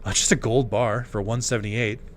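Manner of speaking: ordinary delivery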